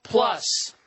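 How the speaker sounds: lone breathy Plus after a pause; dramatic isolated Plus; stress on Plus. Shown as a demonstration. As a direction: hit Plus on its own. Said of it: "They told me to stress plus".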